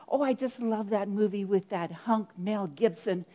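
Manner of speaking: ordinary talk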